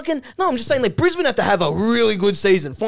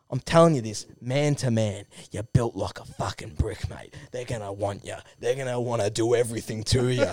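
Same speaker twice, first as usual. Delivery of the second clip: low, hushed man-to-man aside; Duck, confidential; 10 semitones lower, 8 dB quieter than usual. Acted. Veteran taking a young player aside, low and confidential. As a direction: drop right down low and soft, confiding. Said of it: "he's like".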